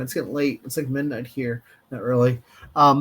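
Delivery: usual speaking voice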